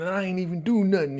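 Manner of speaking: high, fast aggrieved whine; mock heartbroken whimper; whining. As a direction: complain like it's so unfair. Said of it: "He all whining and bitching and moaning".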